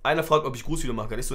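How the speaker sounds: normal talking voice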